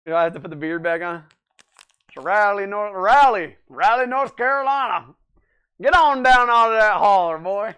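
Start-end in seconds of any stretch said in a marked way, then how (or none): 2.1-5.1 loud, high Southern country voice saying "Raleigh, North Carolina"; country accent. Shown as a demonstration. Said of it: "my country three D accent". 5.8-7.8 loud, high hammed-up Southern hillbilly calling. Shown as a demonstration. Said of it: "my country three D accent".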